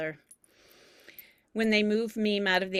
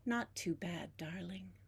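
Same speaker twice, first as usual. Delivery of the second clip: much softer, barely there; faint reply; 9 dB quieter than usual. Acted. Dying grandmother answering granddaughter, much softer and faint. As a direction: answer weakly but lovingly.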